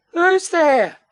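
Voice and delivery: high, anxious call; frightened. A narrator's reading. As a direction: call out high, scared of what is below. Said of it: "in a very frightened voice".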